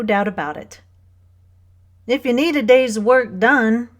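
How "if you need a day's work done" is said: bright cheerful offer, louder and higher. A narrator's reading. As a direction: pitch up, speak up, offer it cheerily. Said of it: "he said cheerfully".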